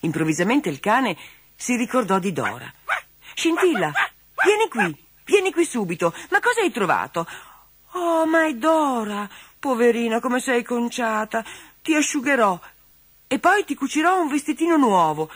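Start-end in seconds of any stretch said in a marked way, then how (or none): 3.3-7.3 high, carrying call to the dog. Acted. Girl Marilena calling her dog Scintilla across the woods, high and calling. 7.9-15.3 high, tender, slowed sympathy; full of pity. Acted. Girl Marilena finding her ragged doll, tenderly pitying. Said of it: "esclamò Marilena impietosita".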